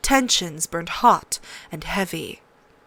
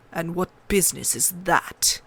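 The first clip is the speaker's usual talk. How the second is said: icy; 3 semitones lower, 3 dB quieter than usual. A narrator's reading. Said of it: "Ned put ice in his voice".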